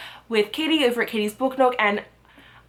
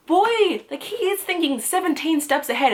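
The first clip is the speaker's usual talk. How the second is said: impressed amazement; 8 semitones higher than usual. Acted. Acting out awe at Kaz's planning.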